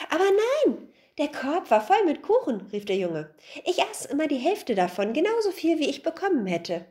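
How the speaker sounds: put-on boy calling out. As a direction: blurt out the innocent excuse. Boy cries out. (hohe, eifrige Jungenstimme, ruft)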